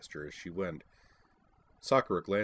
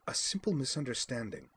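prim, higher and huffy; stuffily; 6 semitones higher than usual. A narrator's reading. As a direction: brush it off with dignity. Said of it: "she said stuffily".

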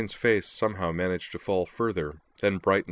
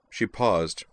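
plain reading voice

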